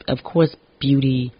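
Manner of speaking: normal talking voice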